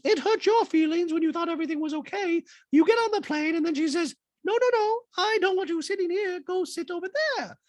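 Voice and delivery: high, loud falsetto; mock housewife recounting in a put-on high voice; Dorit impression voice. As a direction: hold it all up in falsetto.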